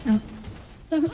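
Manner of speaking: usual speaking voice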